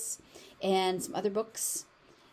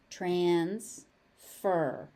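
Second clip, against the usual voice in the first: ordinary talk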